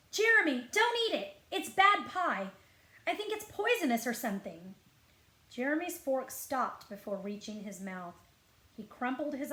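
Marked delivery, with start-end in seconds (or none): 0.1-4.7 loud, high panicked cry to stop him; urgent warning. Acted. Boy warning his friend off the pie, loud and panicked. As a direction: shout to stop a friend eating.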